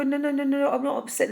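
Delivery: high, rushed protesting no's; flustered denial. Acted. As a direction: insist you're fine while caught crying.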